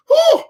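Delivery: very high, loud mocking whoop; mock startled yelp. Coach imitating players when he raises his voice, mock startled yelp. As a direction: squeal it out, imitating a spooked player.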